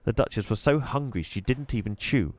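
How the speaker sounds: plain reading voice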